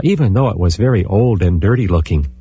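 ordinary delivery

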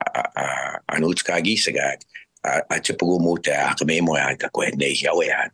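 normal talking voice

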